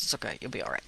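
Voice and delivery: soft, whispered reassurance; a hushed you'll be all right; whispered aside. Real, not acted. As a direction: breathe it like a private joke. Joking reassurance to the congregation.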